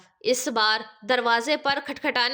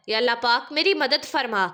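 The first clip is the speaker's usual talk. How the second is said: usual speaking voice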